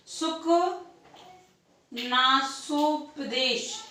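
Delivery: each syllable of सुकनासोपदेश drawn out very slowly; slow dictation. Shown as a demonstration. Teacher dictating the term to students, very slow. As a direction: spell सुकनासोपदेश out syllable by syllable for note-taking.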